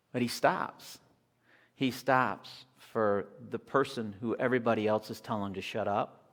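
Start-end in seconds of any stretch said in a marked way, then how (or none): none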